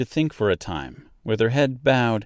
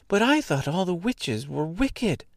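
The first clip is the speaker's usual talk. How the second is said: put-on high girl's voice, a touch of fear; half frightened; 10 semitones higher than usual. A narrator's reading. Girl facing a real witch, half frightened. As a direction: protest timidly, pitched high. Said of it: "who was half frightened".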